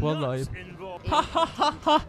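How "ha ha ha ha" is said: mock laugh. Mock villain laugh at characters.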